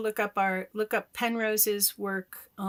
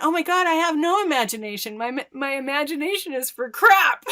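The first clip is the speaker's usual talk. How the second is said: higher, louder, fast self-mocking exclaim; mock dismay; 6 semitones higher, 5 dB louder than usual. Learning her visions were real, mock dismayed. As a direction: exclaim in hammed-up self-mockery.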